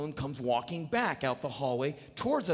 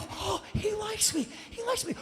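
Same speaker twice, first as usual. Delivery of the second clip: giddy delight. Retold or imitated. Thrilled Ike is friendly.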